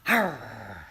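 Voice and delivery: low snarling animal growl; low mock beast snarl. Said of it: "what does it do".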